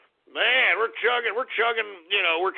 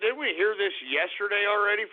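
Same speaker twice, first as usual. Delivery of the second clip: usual speaking voice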